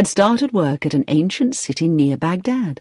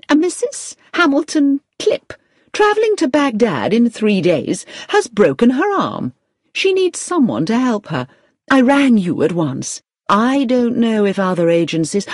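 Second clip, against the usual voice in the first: raised pitch, urgent gush of news; excited; 6 semitones higher than usual. A narrator's reading. Agency manager tells Victoria of an opening, excited. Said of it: "who said excitedly".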